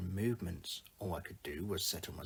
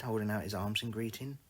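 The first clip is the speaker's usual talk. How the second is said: ordinary delivery, nothing marked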